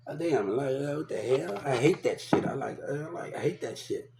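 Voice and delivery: quiet, understated mock grumble; retold sulky grumbling. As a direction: grumble softly to yourself, imitating a pissed-off kid. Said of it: "I'd be pissed the fuck off".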